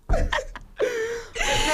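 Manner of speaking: words squeezed out through laughter; can barely speak for laughing; laughing hard. Real, not acted. Laughing hard mid-story.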